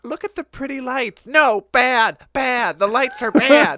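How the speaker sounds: put-on high, loud, childish scolding voice; mock onlooker. An Australian onlooker scolding the falling debris, high and loud. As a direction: coo then scold the lights like a child.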